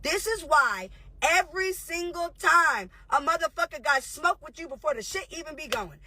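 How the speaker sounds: ordinary talk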